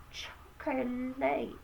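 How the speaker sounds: "chocolate" slowly, every syllable; "choc-o-late" spelled out by sound, ending like "late". Shown as a demonstration. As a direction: say "chocolate" the way it is written. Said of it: "like this".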